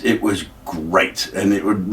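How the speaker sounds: normal talking voice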